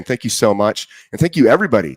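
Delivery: usual speaking voice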